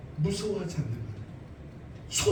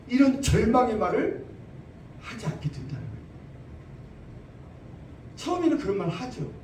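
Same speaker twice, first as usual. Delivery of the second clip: ordinary talk, nothing marked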